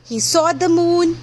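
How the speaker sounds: plain reading voice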